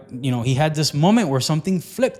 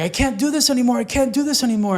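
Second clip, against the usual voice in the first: high-pitched pleading at breaking point; mock desperate, raised-pitch protest; acted-out desperation; 9 semitones higher than usual. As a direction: plead like a smoker who can't go on.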